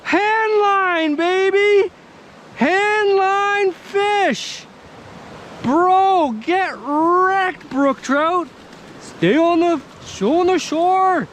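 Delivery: high-pitched thrilled yelling; excited shouting. Real, not acted. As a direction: whoop like you just landed it. Landing a trout, celebrating.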